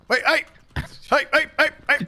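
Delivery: calling out sharply. Acted. Sheriff yelling at the man.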